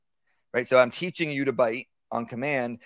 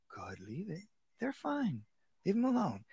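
quiet praise rising higher; soft calm reassurance; 6 semitones higher, 13 dB quieter than usual. Shown as a demonstration. Trainer softly telling the dog the visitors are fine. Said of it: "if you make something a big deal".

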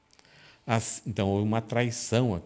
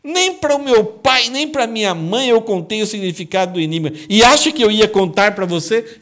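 loud, high exasperated retort; Samson retorts; 13 semitones higher, 13 dB louder than usual. Acted. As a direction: throw it back at her, incredulous and loud. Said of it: "Mas Sansão respondeu".

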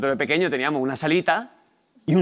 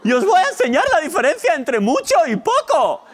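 very high, loud puppet voice; high-pitched Muppet impression; Coco; 12 semitones higher, 5 dB louder, slower than usual. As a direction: shout like an excitable children's TV puppet, drawn out. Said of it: "Por ejemplo, con Coco, de Barrio Sésamo".

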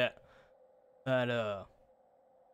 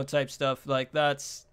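normal talking voice

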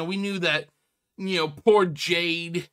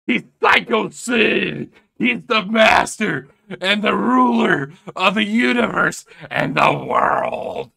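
hammed-up proclamation; 3 semitones higher, 6 dB louder than usual. Commentator crowning the psychopath caller, in hammed-up proclamation. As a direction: proclaim it like an over-the-top announcer.

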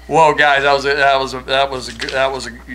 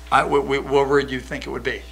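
usual speaking voice

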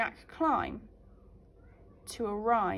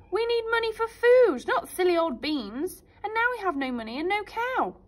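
louder, higher scolding mum; angry mum; 9 semitones higher, 7 dB louder than usual. Acted. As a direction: scold Jack for the beans. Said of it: "said Mum angrily".

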